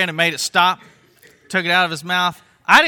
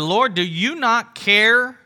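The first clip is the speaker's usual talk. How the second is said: slow, raised, loud complaint; irritated plea; 6 semitones higher, 4 dB louder, slower than usual. Retold or imitated. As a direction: plead with mild irritation, drawn out. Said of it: "She said".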